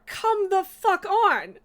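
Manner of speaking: slow, loud, drawn-out frustrated yell; exasperated outburst. Real, not acted. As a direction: let the anger spill out, stretching each word. Raging at failed dungeon group.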